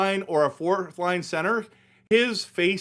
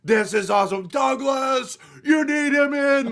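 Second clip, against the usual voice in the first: higher, cheering fan shout; mock cheering fans; 9 semitones higher, 3 dB louder than usual. Fan cheering for Douglas, a mock cheer. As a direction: cheer like a crowd chanting a player's name. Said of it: "we're like".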